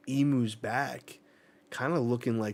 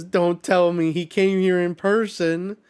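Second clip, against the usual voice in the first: much higher, loud, alarmed outburst; shocked disbelief; 8 semitones higher, 10 dB louder than usual. Acted. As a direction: blurt it out as if the worst news just landed. Reading a character's shocked line.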